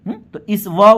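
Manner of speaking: normal talking voice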